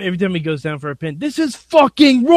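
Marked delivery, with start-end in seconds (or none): 1.2-2.4 Gordon Ramsay. Pretend. Angry chef refereeing a wrestling pin, outraged shout. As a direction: shout it like a furious celebrity chef. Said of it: "Gordon Ramsay ref-, referees, of course".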